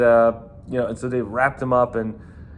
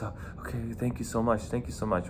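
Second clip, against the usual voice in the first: much softer, meek grateful murmur; meek thanks; 8 dB quieter than usual. Retold or imitated. Released kid thanking the bouncer, soft and meek. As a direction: thank him quietly, relieved.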